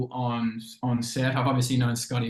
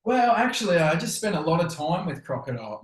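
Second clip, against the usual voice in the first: mock boast; 6 semitones higher than usual. Mock serious joke.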